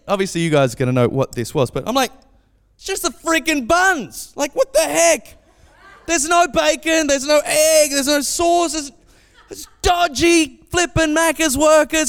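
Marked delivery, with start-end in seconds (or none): 2.8-12.1 high, drawn-out mock outrage; hammed-up childish indignation; indignant kid. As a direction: complain slowly like a cheated child. Child outraged at empty muffins.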